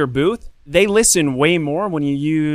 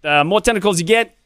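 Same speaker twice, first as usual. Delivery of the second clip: high-pitched Jersey sales pitch; New Jersey accent; 6 semitones higher, 3 dB louder than usual. Acted. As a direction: pitch the game like a Brooklyn-Jersey hawker. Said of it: "this accent was not my idea".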